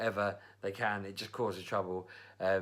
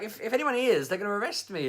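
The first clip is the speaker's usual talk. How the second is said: alarmed hairdresser; 10 semitones higher, 5 dB louder than usual. Retold or imitated. Imitates alarmed hairdresser pleading.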